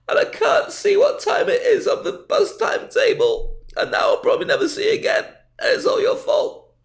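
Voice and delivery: high whiny squeal. Retold or imitated. The snubbed northerner whines back at the scared stranger, high and tearful. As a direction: wail like a wounded victim, half sobbing. Narrator's own squealing reply.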